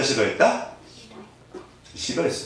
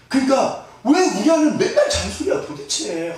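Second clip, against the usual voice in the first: high, whining husband's gripe with a laugh; hammed-up complaining husband; whiny husband; 11 semitones higher than usual. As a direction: play the fed-up husband moaning about nagging. Husband complaining about wife.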